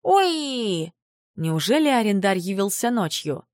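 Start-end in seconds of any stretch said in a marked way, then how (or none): 0.0-0.9 high, sweet little moan; creature moan. Acted. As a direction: coo like a tiny creature. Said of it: "Сладкий стон Пушинки".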